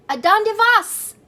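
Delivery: high, loud call across the river. Acted. Kitten hollering to his friends, high and loud. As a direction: call out to the dogs on the crocodile. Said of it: "called out Skipitito".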